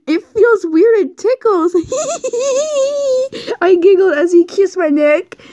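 high, giggling read; high giggly narration. Acted. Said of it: "I would giggle a bit".

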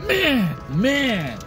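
amped-up "man, man"; excited. Real, not acted. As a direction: hype it up. Excited praise after eating.

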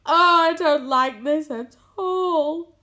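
high, loud, stretched-out wail. A narrator's reading. Earthworm after the seagull's attack, wailing. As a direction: wail it slowly in dismay. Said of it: "wailed the earthworm".

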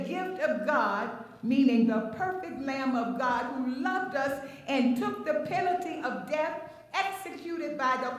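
preacher rolling on with few breaks; brisk run-through. Real, not acted. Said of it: "If I went fast".

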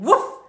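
short, loud woof; dog woof. A narrator's reading. Storyteller plays the dog, loud barking. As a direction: bark like a dog. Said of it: "said the dog".

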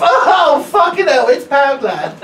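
put-on high, booming drunk exclamation; drunken loud arrival. Drunk customer announcing her arrival to the shop, loud yell. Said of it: "announces her arrival with the oh-so-eloquent statement".

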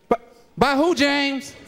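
high, incredulous squeak with a laugh; shocked disbelief. Acted. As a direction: play her as floored by the news. Girlfriend shocked at pregnancy call.

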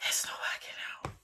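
very soft whispered. Retold or imitated. Ending the date, whispered. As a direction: breathe it out.

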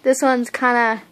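lip corner pulled back; speaking with finger stretching lips; talking with finger in mouth. Real, not acted. Seen 2: Finger hooks mouth corner showing braces until 3.1, then removed.

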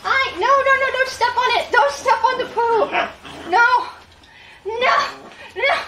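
urgent no. Real, not acted. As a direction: beg the puppy not to step in it. Puppy stepping toward poo.

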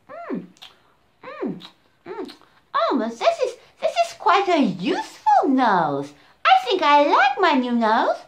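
high, delighted munching voice; happy little elephant. Acted. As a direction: savour the apple, pleased. Elephant enjoys apples.

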